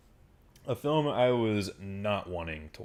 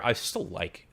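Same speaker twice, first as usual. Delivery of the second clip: normal talking voice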